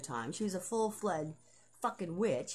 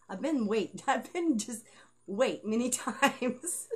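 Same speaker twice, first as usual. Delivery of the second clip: laughing; 4 semitones higher, 6 dB louder, slower than usual. Real, not acted. Laughing at own joke.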